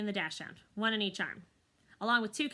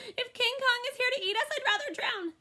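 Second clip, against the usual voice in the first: terrified bunny; 5 dB louder than usual. Acted.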